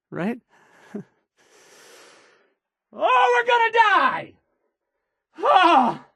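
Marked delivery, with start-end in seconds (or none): 2.9-6.1 mock panic. Mock panic at raiders news.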